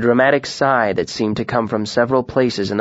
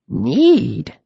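doubting, raised-brow question; skeptical; 5 semitones higher than usual. A narrator's reading. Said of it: "asked skeptically".

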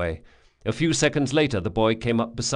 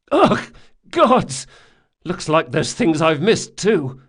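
high, loud revulsion; disgusted startle; 7 semitones higher, 7 dB louder than usual. Acted. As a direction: recoil in disgust. Locke sees spider carcasses.

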